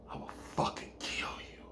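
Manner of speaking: hushed, whispered death threat; menacing whisper. Acted. Woman with butcher knife threatens him, whispering menacingly. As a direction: whisper it, very soft and quick.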